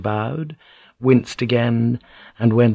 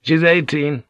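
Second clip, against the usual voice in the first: higher, matter-of-fact retort; dry correction; 6 semitones higher than usual. A narrator's reading. Mura corrects his mother on age, dryly. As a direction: put her right dryly. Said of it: "Mura said dryly".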